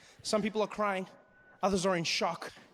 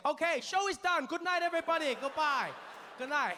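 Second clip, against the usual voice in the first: higher-pitched, overcheery goodbye to the crowd; hurried showman sign-off; 6 semitones higher than usual. Retold or imitated. Snake charmer abandoning his show, mock-cheerful farewell. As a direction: end the show in a raised, panicky voice.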